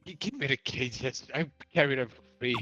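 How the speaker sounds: pleading goblin. Acted. Goblin pleads with the old lady.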